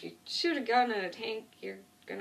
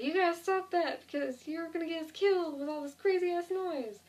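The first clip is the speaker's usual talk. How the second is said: high and loud, telling him off; urgent yell; 9 semitones higher, 3 dB louder than usual. Retold or imitated. The group yelling at Merle to stop shooting, high and loud.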